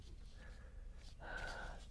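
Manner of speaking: long, quiet sigh; quiet sigh at the small print; weary sigh. Real, not acted. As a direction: let out a soft exasperated breath.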